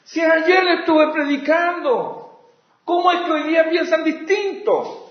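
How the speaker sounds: pleading apostle. Retold or imitated. Paul pleading with the fickle Galatians, bewildered. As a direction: ask it as a hurt father would. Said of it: "lo que Pablo dice".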